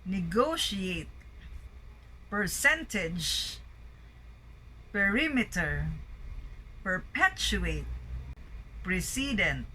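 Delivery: "negotiate, percentage, perimeter, perpetuate, precedent" each punched on syllable two; second-syllable stress. Shown as a demonstration. Teacher reading out a word list, stress on the second syllable. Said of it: "stress on the second syllable".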